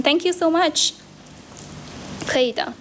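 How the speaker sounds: ordinary talk, nothing marked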